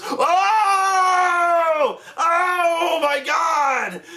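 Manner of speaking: imitating over-the-top awe, high and drawn out; hammed-up, high wail of delight; mock a guy who's far too easily amused; amazed meathead. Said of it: "so easily amused by this shit".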